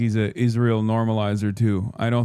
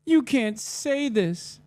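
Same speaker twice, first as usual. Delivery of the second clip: high, slow whiny mockery; mocking whiny fan; 11 semitones higher, much slower than usual. Streamer mocking fans who cry online, high and whiny. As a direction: whine it like a crybaby, much higher. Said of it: "They're like".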